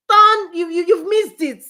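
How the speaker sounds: high, loud rushed cry; flustered loud mock sat-nav. Speaker mocking Google Maps, loud flustered missed-turn cry. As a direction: blurt it out like a panicked GPS. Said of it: "You say".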